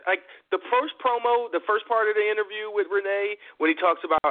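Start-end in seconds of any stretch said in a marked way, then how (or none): none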